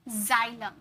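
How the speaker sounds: जाइलम slowly, starting with a buzzing z; जाइलम as zylem, slow; z sound. Shown as a demonstration. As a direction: draw out जाइलम with a clear z. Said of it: "जैसे जाइलम".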